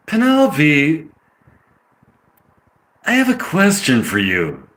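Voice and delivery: drawn-out California accent. Shown as a demonstration. Actor trying out lines in a California accent. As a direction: try a slow California drawl. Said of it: "is that a California accent?".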